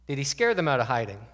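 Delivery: ordinary delivery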